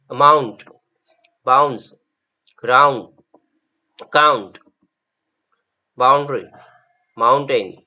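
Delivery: loud teacher drill of English words; firm, loud reading of vocabulary. Shown as a demonstration. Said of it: "असा उच्चार होणारे शब्द".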